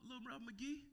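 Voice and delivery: nickname sung out high by the friend; friend's nickname. Retold or imitated. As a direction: call the name up high like the old friend. That's what he used to call me.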